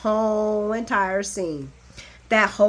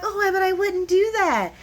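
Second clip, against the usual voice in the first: high, put-on innocent voice; sweet fake denial, high; mock innocent Lois; 10 semitones higher than usual. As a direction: play the innocent mother, pitched up. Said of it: "Lois is like".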